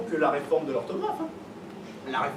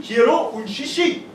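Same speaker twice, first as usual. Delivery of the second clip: mild mock shout; 4 semitones higher, 6 dB louder, slower than usual. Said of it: "t'as bien gueulé un peu partout".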